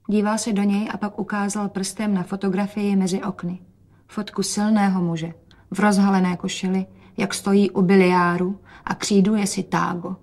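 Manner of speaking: plain reading voice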